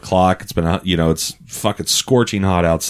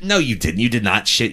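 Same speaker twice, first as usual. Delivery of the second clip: lightly imitated castmate in disbelief; Harvey Fierstein. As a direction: protest in his put-on voice. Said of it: "he's like".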